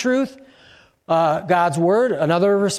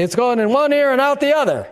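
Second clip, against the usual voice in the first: dad's saying; 5 semitones higher than usual. Retold or imitated. Pastor quoting his dad to the church, lightly voiced father. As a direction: toss it off like an old family saying. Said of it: "like my dad used to say".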